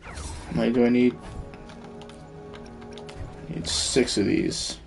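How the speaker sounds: usual speaking voice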